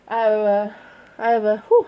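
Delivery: winded, puffing between words; breathless sigh mid-sentence; out of breath. Real, not acted. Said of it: "I'm out of breath".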